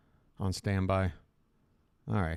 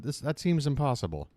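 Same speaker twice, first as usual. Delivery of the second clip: ordinary talk, nothing marked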